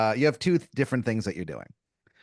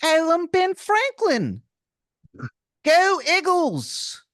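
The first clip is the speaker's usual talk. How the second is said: much higher, slow, hammed-up Philadelphia accent as Ben Franklin; squeaky, high, slow caricature of a Philadelphia accent; terrible Philly accent; 4 dB louder, much slower than usual. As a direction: grate it out in an over-the-top Philly accent. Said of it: "the worst Philadelphian accent".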